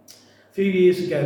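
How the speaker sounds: ordinary talk, nothing marked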